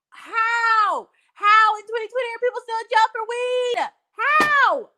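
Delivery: high, loud, incredulous cry; outraged disbelief. Retold or imitated. Reacting to people still jailed for weed, outraged disbelief. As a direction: blurt it high and loud, demanding an answer.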